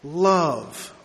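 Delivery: love drawn out and weighted in a changed voice; stressed love. Shown as a demonstration. Pastor shows the congregation where to put the stress: love, weighted. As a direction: lean hard on the single word love. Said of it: "place the emphasis on the first word".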